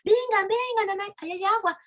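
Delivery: high-pitched cry of discovery; acted crowd yelling at sighting water; excited shouting. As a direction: call the others over, high and urgent. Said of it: "empiezan a gritar".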